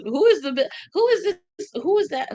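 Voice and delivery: high, baffled questions; puzzled. Real, not acted. Streamer baffled by commenters she cannot see. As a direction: squint at the screen, asking who that is.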